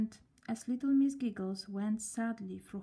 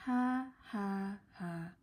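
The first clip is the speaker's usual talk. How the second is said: fading, joyless ha ha; doleful laugh; much slower than usual. A narrator's reading. Sad girl trying a doleful laugh. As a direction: force a hollow laugh. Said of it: "she said dolefully".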